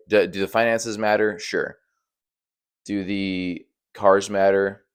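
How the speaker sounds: usual speaking voice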